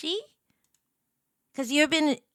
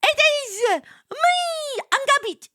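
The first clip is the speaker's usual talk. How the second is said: mascot voice; 13 semitones higher, 7 dB louder than usual. Acted. Streamer voicing the plushie introducing itself, high and proud. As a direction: present yourself like a superhero pup.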